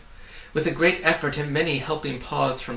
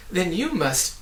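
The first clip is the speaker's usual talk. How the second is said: a touch higher, startled gasp; 6 semitones higher, 3 dB louder than usual. A narrator's reading. Milo realising the trap, gasping. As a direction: catch the breath in alarm. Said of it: "gasped Milo".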